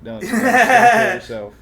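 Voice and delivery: loud, high laughing words; real laughing plea. Real, not acted. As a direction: slowly push the words out through laughter. Laughing at dark joke.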